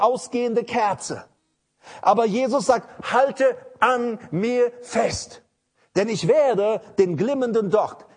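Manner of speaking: full-force pulpit delivery; fiery preaching. Acted. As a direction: preach it out to the congregation.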